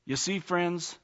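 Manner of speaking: ordinary delivery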